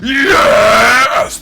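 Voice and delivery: high, loud, strained battle cry; mighty yell. Retold or imitated. Mumen Rider rearing up his fist, high loud yell. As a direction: let it rip like your last stand. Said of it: "lets out a mighty yell".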